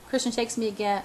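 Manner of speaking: plain reading voice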